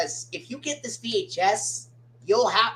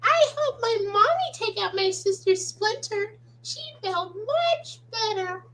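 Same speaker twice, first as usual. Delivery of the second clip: put-on very high childlike voice; tiny high-pitched character impression; little cartoon character; 12 semitones higher than usual. Telling friends about helping mommy, very high character voice. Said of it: "why don't we do a quick impression".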